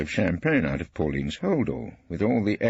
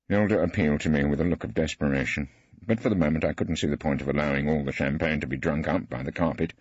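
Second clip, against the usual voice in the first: ordinary delivery, nothing marked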